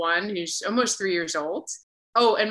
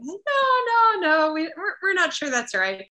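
dismissive family; 12 semitones higher than usual. Retold or imitated. Family answering her high school coming out, imitated dismissive protest. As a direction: voice their quick denial, pitched well up.

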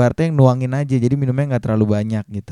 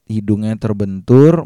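ordinary talk, nothing marked

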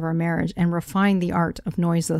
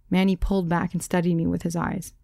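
plain reading voice

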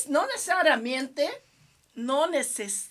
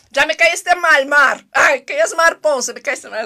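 loud joking scolding; mock annoyed; 12 dB louder than usual. Teasing a viewer who praised Camila's accent, loud mock offence. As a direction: pretend to be fed up, much louder. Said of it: "es broma".

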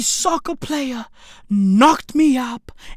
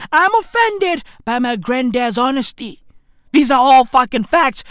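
ordinary talk

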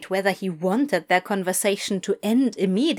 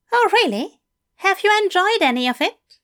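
high, eager English teacher; bright, keen invitation from a fussy gentleman; 9 semitones higher, 5 dB louder, faster than usual. A narrator's reading. Fell voice.

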